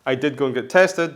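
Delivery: ordinary talk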